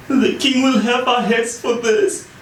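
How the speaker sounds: a touch of grim worry; mildly grim, fearing the king; grim king's men. A narrator's reading. King's men fearing punishment over the horse, grim. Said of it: "the men said grimly".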